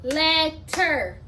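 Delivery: "Letter" in two beats, let, then ter; syllabicated. Shown as a demonstration. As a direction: break "Letter" between its syllables. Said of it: "I'm gonna syllabicate it".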